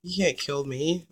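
normal talking voice

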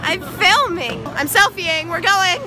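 at a bowling alley. Real, not acted. Calling out over a bowling alley.

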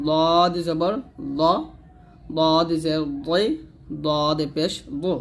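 slow "দদ জবর দ" with a thick, rounded d; heavy "দদ". Shown as a demonstration. Teacher showing it is unlike dal, heavy "দদ". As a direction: draw out "দদ", full and heavy. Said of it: "দদ অবশ্যই মোটা করে উচ্চারণ".

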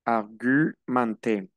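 argumenté slow, argü-man-té, u rounded; French ü and nasal. Shown as a demonstration. Teacher reminding students of the French U: argumenté, slow. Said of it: "acuérdense que la U es U".

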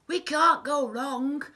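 high, loud villain voice; Hugh the crook. A narrator's reading. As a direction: crow it like a confident thief. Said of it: "said Hugh".

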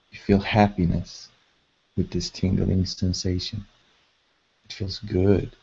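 measured, level delivery, leaving space to breathe; guided meditation. Acted. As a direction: guide them gently into calm, phrase by unhurried phrase.

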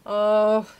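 long, loud, drawn-out moan; hammed-up group groan. A narrator's reading. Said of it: "they all moaned".

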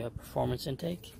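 ordinary talk, nothing marked

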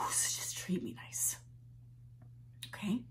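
soft, breathy whisper; whispered plea. Acted. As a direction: plead in a whisper.

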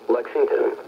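ordinary talk, nothing marked

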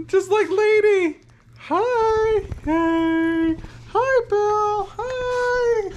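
very high, squeaky doggy cooing. Real, not acted. Owner fusses over the dog, high squealed greeting. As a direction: squeal sweetly at your dog.

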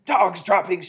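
high, very loud alarm; yelled. A narrator's reading. Witch announcing the smell to the others, yelled. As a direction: yell it out as a discovery. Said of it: "she yelled".

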